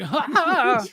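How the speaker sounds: hammed-up laugh at an in-joke; mock laugh. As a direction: fake a self-satisfied laugh. Mimics Marvel's smug in-joke reaction.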